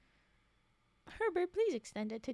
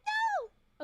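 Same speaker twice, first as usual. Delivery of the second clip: very high yelp of protest; sudden high-pitched outburst; mock horrified cry; 8 dB louder than usual. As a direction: cry out in playful horror. Outburst at the song of death.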